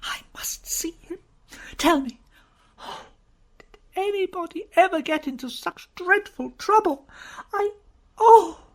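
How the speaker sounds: high, breaking into a hysterical cry; wailing in desperation; high, sobbing hysterical outburst; desperate vehemence. Acted. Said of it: "wringing her hands with desperate vehemence".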